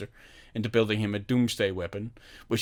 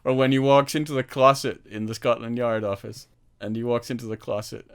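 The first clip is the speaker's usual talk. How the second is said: ordinary delivery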